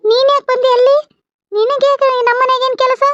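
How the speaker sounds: high-pitched indignant questioning; indignant challenge. Acted. Challenges the stranger in her house.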